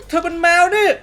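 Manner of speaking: gasp, then high, loud exclamation; astonished realization. Acted. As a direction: blurt it out in amazement. Realises she is a cat.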